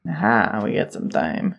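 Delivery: very low, soft mock Texan; bad Texas accent. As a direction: drop way down into an amateur Texas accent. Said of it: "Doing a really bad Texas accent".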